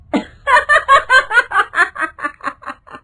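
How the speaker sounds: loud, high-pitched cackle; shrieking witch laughter, dying away; evil witch cackle. Acted. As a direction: burst into a piercing villainous laugh. Witch bursts out of the well.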